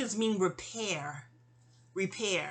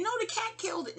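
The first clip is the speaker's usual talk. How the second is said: much higher, insistent protest; 13 semitones higher than usual. Retold or imitated. Arguing with the psychic, high insistent protest. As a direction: protest it like a stubborn kid. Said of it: "I'm in the reading".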